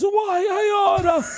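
very high, drawn-out mock wail. Boyfriend answering her rant, hammed-up high wail. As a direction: wail it slowly in a silly high voice. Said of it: "Like that".

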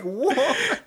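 high, laughing "What?"; stunned squeak of disbelief. Retold or imitated. Retelling his reaction to the kid, laughing high. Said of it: "I'm like".

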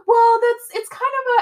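high, loud flustered excuse; high mock stammer. Kevin fumbling an excuse at gunpoint, high and flustered.

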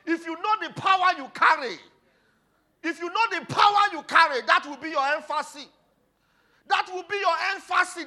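high, shouted Pentecostal exhortation; impassioned pulpit shouting; full-force preaching. Real, not acted. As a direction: preach it at the top of your voice.

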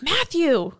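high, reproachful drawn-out name; mock exasperated daughter; a hint of an embarrassed teen; scolding teen. Abby scolding her father.

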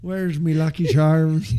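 playful put-on Irish accent, drawn out slow. Joking to his buddy about the Guinness, mock Irish accent. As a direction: ham up a stage Irishman over a pint. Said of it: "before you go into the Irish".

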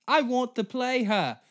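high, loud pompous actress voice; a greedy star choosing her next queen; mock actress. Mocking the actress as she picks a role, high and loud.

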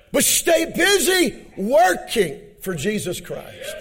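high-pitched, drawn-out pulpit cry; full-force preaching. Acted. Preacher urging the church to stay busy, full-force preaching. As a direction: preach it out, stretching the key words.

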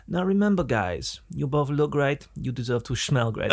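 German salesman's parting one-liner; German accent. Retold or imitated. German salesman turning back as he leaves, German accent. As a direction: deliver the sign-off in a hammy German accent. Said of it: "misrepresentation of the German accent".

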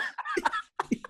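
high-pitched helpless laughter. Real, not acted. Host cracks up mid-joke, high laugh.

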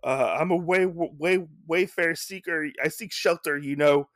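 high, loud, begging to be let in; pleading high voice. Retold or imitated. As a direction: pitch up and plead at the door. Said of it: "he's like".

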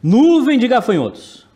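dramatic headline read. Acted. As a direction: announce it like breaking news. Reads news headline dramatically.

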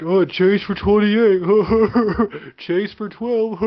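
high, slow sneering voice with laughs; mock them, high and drawn out, laughing; mocking quote. Mocking hosts' cheers for a player, high and slow. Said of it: "When you're like".

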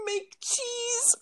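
high-pitched repeat through laughter; helplessly amused, voice squeezed high; laughing. Real, not acted. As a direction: crack up while echoing the joke. Cracks up repeating the joke.

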